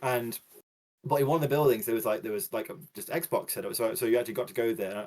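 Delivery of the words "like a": ordinary delivery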